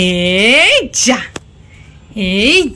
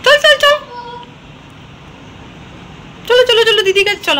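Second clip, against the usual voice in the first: excited calling; 10 semitones higher, faster than usual. Real, not acted. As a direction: call the dog over brightly.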